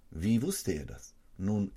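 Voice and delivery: ordinary delivery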